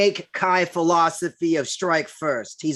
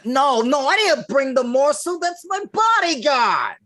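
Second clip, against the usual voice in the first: high, loud, flustered denial; panicked; 9 semitones higher, 4 dB louder than usual. Acted. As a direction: panic to disown him.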